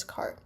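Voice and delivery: "car" with the final R sounded; American pronunciation. Shown as a demonstration. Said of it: "in American pronunciation".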